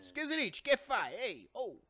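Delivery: high, exclaimed hammed-up Italian; mock Italian. Host spars with co-host in pretend Italian, high and exclaiming. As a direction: fire off excitable fake Italian.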